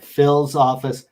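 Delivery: usual speaking voice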